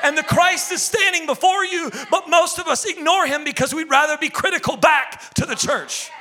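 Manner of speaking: loud, high, driving sermon; fiery preaching. Acted. Pastor rebukes the church from the pulpit, fiery preaching. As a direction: preach it hard to the congregation.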